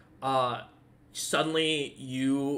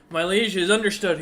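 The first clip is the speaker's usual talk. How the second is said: orc messenger; 4 semitones higher, 7 dB louder than usual. Acted. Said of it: "and he goes".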